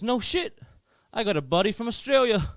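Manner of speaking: high, loud impressed American; loud, high chummy exclamation; friendly American guy. Retold or imitated. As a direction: imitate an excited American barman. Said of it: "Really friendly guy working".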